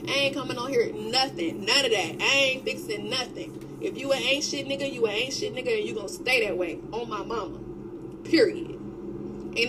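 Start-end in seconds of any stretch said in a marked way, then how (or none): none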